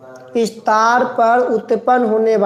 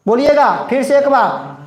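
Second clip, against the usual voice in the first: ordinary delivery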